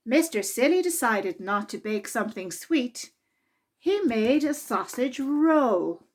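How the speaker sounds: lively, expressive read-aloud voice; children's story reading. A narrator's reading. Narrator reading a Mister Men story to children, lively and expressive. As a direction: read it to a room of small children. Reads picture book aloud.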